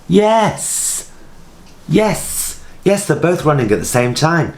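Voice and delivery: high, triumphant yes; excited eureka. Real, not acted. Solving the camera timer, she cheers excitedly. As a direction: cheer the discovery out.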